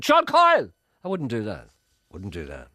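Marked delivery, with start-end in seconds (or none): 0.0-0.7 high, loud yell of the full name; loud mock shout. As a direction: holler the full name down the street. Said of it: "I wouldn't be shouting".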